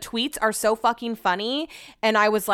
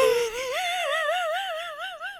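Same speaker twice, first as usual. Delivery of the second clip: long high laugh that turns into crying; laugh-sob; 4 dB quieter than usual. Real, not acted. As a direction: give in to a high teary laugh. Overwhelmed, laughing through tears.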